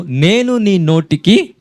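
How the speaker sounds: "నేను" stressed with a rise, "నీ నోటికి" said very slowly; slow emphatic quote. Shown as a demonstration. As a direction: drag out the promise, word by word. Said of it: "ముందు వచనంలో ఏమని అన్నాడు?". ("నేను నీ నోటికి" చాలా నెమ్మదిగా ఒత్తి చెప్పడం)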